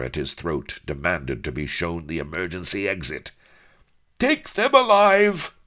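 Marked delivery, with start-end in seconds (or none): 4.2-5.5 high shrill-free shriek, slowed and loud; villain shriek. A narrator's reading. Don Lardo shrieking at his men to take them alive. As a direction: shriek the order like a frantic villain. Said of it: "shrieked Don Lardo".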